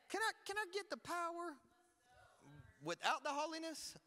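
high-pitched wheedling request; mock pleading. Preacher mimics people who want power without holiness, mock pleading.

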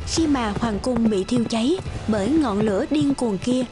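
ordinary delivery, nothing marked

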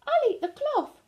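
very high squeaky-happy moth; a lightly played cheerful little moth. A narrator's reading. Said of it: "says happy moth".

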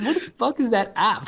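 ordinary talk

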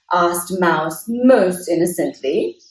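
ordinary delivery

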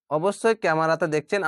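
normal talking voice

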